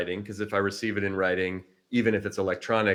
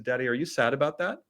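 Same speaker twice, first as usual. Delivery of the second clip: lightly voiced child asking; higher, with a questioning lift at the end; a hint of a little girl's concern; imitated young daughter; 5 semitones higher than usual. Retelling four-year-old daughter's question.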